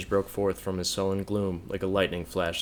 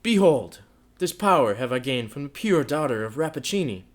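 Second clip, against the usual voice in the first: loud, scornful declaration; loud bitter triumph; bitter boast; 5 semitones higher, 8 dB louder than usual. A narrator's reading. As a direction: throw the proof in her face.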